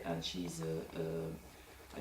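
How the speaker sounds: hesitant word search in English; grasping for the English word. Real, not acted. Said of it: "I don't know the word in English".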